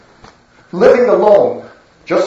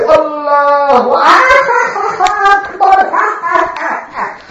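very high scornful laugh after the phrase; mocking laughter; 9 semitones higher than usual. Gang mocking the prophet's call, high scornful laughter. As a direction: sneer then cackle. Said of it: "he's going to say".

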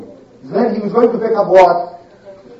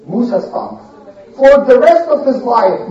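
plain reading voice

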